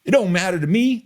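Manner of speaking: high, fast mock of his real accent; imitating Brad's offline accent. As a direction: tease a cohost with a hammed-up version of his offline voice. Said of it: "This is how Brad talks when we're offline".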